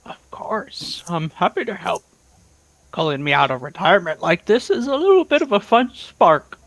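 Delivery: lightly voiced old man; cheerful old-timer, a little worn; old prospector. Old character answers Ira.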